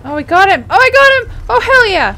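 real celebration, climbing to a loud high yell; triumphant shout. Real, not acted. Player cheering after finally getting him, triumphant shouting. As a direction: build into a jubilant holler.

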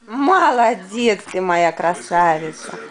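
usual speaking voice

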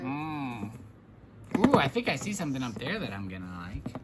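deep toy voice. Pretend. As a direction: drop the voice way down for the toy. Toy character voiced low.

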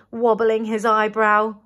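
ordinary delivery

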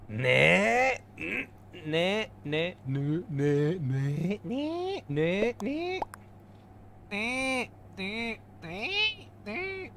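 high, rising cute calls; playful anime girl nagging. Retold or imitated. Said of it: "You're really asking for, like, straight up girls".

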